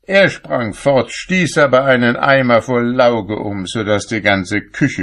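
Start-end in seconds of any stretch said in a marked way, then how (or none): none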